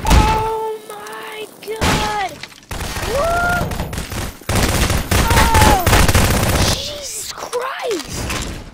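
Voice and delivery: high, loud yelps of shock; startled shrieks. Real, not acted. Gamer shrieking at a sudden in-game scare. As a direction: jump out of your skin.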